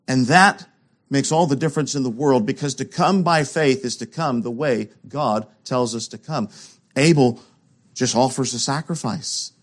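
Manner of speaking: usual speaking voice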